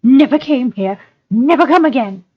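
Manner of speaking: high, pleading creature voice; Gollum, whimpering. Acted. Captive denying he was ever here, high whimpering Gollum.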